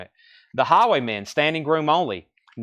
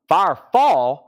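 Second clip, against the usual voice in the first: loud, strong Southern drawl; Southern accent; 5 semitones higher, 6 dB louder, slower than usual. Real, not acted. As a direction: let the Southern accent come right out, higher and louder. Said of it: "My southern accent really came out then".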